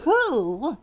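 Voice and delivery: high, startled exclamation; exclaimed. Acted. Mister Fussy confronts a stranger, high startled exclamation. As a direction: squeak it in fussy disbelief. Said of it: "exclaimed Mister Fussy".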